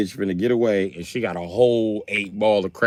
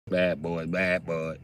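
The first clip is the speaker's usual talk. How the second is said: ordinary talk, nothing marked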